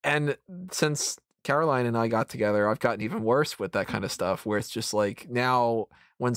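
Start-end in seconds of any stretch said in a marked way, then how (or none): none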